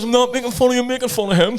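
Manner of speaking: loud, high put-on speech impediment; cleft palate speech. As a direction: insist in a mock cleft-palate voice. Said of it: "I've got a cleft palate".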